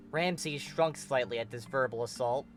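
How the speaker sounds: ordinary delivery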